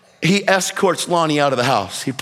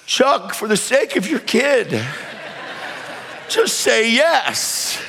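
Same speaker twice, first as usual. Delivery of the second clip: drawn-out, mildly raised plea; imploring; 5 semitones higher, slower than usual. Retold or imitated. Viewer urging the dad to say yes for his kid, imploring. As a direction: beg him, stretching out the last words. Urging film character while daughter cries.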